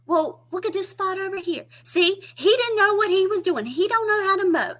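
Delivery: high, loud fussy mother; mama complaining. Retold or imitated. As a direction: complain like an old mama about the mowing. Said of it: "She said".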